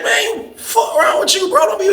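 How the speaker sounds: what is wrong with you, bro; incredulous snap. Retold or imitated. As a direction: snap at him half laughing. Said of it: "I gave him the look like".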